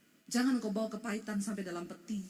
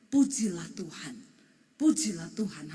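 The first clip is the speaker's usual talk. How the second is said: whispered praise; 6 semitones lower, 5 dB louder than usual. Acted. Psalm quoted in hush.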